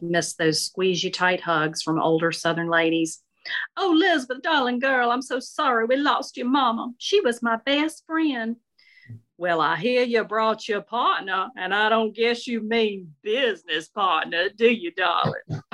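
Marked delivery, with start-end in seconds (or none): 3.8-8.6 put-on high, sweet older Southern lady. Shirley consoling Elizabeth at the funeral, sweet high Southern lady voice. 9.4-15.6 teasing Southern lady. Acted. Shirley teasing about partner.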